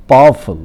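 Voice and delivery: "Powerful" with पा punched loud, then dropping; first-syllable stress. Shown as a demonstration. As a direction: drill "powerful", hitting the first syllable hard. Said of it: "put stress on the first syllable". ("Powerful" के पहले syllable पर ज़ोर)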